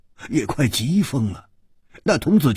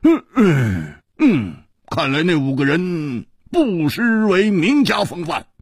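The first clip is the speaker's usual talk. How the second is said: relieved, pitched up after a long exhale; big relief, as if a worry has lifted; relieved sigh; 7 semitones higher, 4 dB louder than usual. A narrator's reading. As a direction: let out a long breath of relief, then speak higher. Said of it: "长长地松了一口气".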